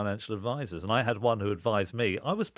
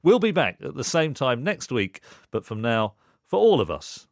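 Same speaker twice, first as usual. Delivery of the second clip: radio sign-off read; 5 dB louder than usual. Acted.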